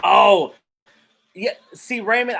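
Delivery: ordinary talk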